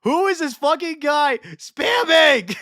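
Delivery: slow, high, loud yell; shouting. Real, not acted. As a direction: bellow at the chat. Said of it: "never heard you raise your voice like that".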